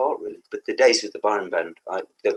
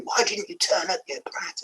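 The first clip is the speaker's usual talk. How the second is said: mock angry fan; 10 semitones higher, 3 dB quieter than usual. Angry fans quoted at him.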